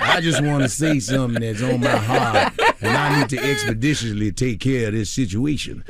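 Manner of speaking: a light, laughing take on [name]'s earnest woods video; imitating [name]. Comedian mimicking [name]'s Instagram Live from the woods, lightly voiced. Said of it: "You know when [name] goes in the woods, he has that angle".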